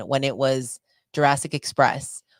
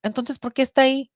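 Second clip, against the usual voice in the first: high, quick, louder Spanish-speaking mother; mock-worried mom, pitched way up; imitating worried mom; 11 semitones higher, 5 dB louder, much faster than usual. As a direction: fret like an anxious mom demanding answers. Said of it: "she was so worried".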